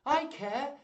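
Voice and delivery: high, loud burst of feeling; impassioned high-pitched outburst; heartfelt young lady. Acted. Rosalind's heartfelt thought.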